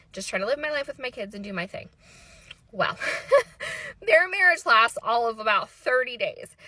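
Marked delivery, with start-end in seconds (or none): 3.0-4.0 high-pitched burst of giggles; can't help laughing. Real, not acted. Teller amused at a short marriage, high giggle.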